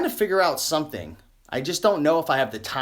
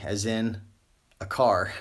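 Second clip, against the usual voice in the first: usual speaking voice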